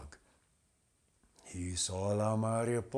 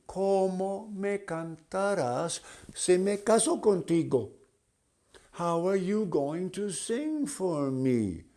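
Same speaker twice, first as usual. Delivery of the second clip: butterfly voice; 9 semitones higher than usual. Acted. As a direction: squeak it out as a dainty butterfly.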